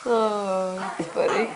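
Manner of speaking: normal talking voice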